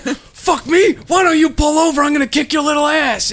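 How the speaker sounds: high, loud mock shout; hammed-up furious dad shouting; imitating dad's shouted threat. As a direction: challenge the kid to pull over.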